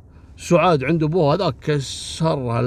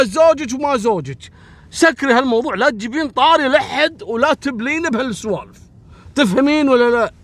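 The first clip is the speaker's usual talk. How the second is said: higher and louder, laying down the law to her; angry shout; 9 semitones higher, 4 dB louder than usual. Retold or imitated. Husband yelling at his wife to drop the subject, angry shout. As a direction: yell the warning at his wife.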